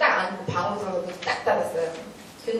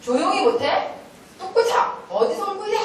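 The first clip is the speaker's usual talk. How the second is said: high, raised-voice telling-off; angry scolding shout; 10 semitones higher, 3 dB louder than usual. Retold or imitated. Teacher shouting at a defiant crying child, angry scolding. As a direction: scold sternly, pitch jumping up. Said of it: "막 화를 냈어요".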